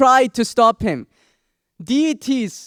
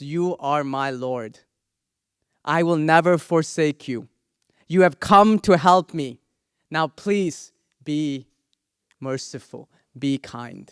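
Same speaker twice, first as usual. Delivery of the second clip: soft, gentle devotee's prayer; tender plea; 6 semitones lower, 3 dB quieter than usual. Acted. Prahlad gently begging the raging Narasimha, soft. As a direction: plead softly with the fierce god.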